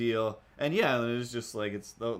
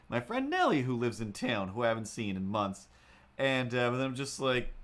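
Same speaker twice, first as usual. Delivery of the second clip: usual speaking voice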